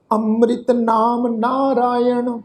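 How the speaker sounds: slow, measured Gurbani recitation; scripture recitation. Shown as a demonstration. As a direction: draw out each word of the verse. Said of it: "ਇਸ ਦਾ ਉਚਾਰਨ ਕਰਦੇ ਹਾਂ".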